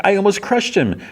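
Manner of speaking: high-pitched mock dismay; retold shock. Princess Bubblegum realises she nearly killed Finn, mock dismay. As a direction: act out horrified realisation in a high voice.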